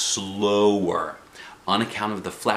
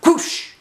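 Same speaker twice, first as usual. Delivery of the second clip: breathy rushing whoosh; whooshing noise; 6 dB louder than usual. A narrator's reading. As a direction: make the sound of a bird zipping past. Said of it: "The noise zoomed by my ear".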